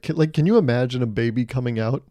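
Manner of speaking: usual speaking voice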